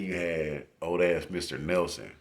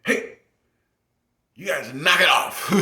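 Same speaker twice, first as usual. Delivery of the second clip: put-on much higher, louder old man yell; 11 semitones higher, 9 dB louder than usual. Old teacher yelling at the class to knock it off. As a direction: shout it like a cranky old referee. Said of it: "he would always be like".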